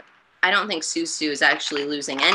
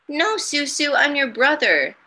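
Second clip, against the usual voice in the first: put-on high, earnest young kid; lightly voiced insistent child; 8 semitones higher than usual. Jackie voiced in story.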